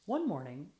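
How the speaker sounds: ordinary delivery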